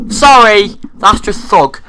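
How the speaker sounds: loud. Real, not acted. Loud joking outburst.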